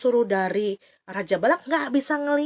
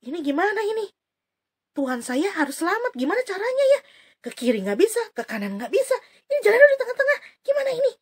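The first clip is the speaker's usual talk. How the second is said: higher, worried puzzled voice; confused donkey; 9 semitones higher, faster than usual. Acted. The trapped donkey wonders aloud, higher and worried. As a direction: fret about where to go. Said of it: "Keledainya bingung".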